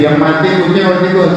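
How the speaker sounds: ordinary talk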